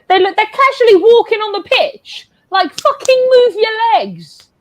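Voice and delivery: high-pitched exasperation at lazy players; exasperated rant. Real, not acted. Fan to players who won't run, exasperated rant.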